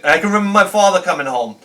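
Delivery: ordinary talk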